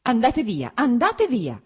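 loud, high, sharp order to leave; cutting shout. A narrator's reading. Wife orders husband away, shouting sharply. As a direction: shout him out of the room, cuttingly. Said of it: "gridò con voce ancora più tagliente".

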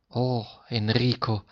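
soft, slow and tender; affectionate exclamation. A narrator's reading. Sick teacher greets his pupil, softly affectionate. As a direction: breathe his name gently, full of fondness. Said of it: "esclamò con la sua voce affettuosa".

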